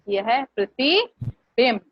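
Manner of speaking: slow, with प्रतिबिंब carried and falling; stress on प्रतिबिंब. Shown as a demonstration. Teacher stresses the word she is teaching. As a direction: lean on प्रतिबिंब and let it fall. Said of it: "अब इसमें है देखने वाली बात".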